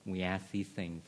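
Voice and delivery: pastoral prayer. Acted. Pastor praying over the room, soft and reverent. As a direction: pray reverently for the congregation.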